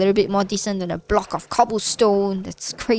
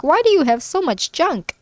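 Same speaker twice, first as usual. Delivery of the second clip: lightly imitated scolding, pitched up; a hint of a nagging wife, higher; 7 semitones higher, 3 dB louder than usual. Wife finding all the stashed junk, mock scolding. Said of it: "My wife is going to scold me".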